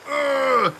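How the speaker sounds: high strained grunt; pained groan. Retold or imitated. Patient pushed back and forth by nurses, pained groan. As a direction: groan as sore muscles move.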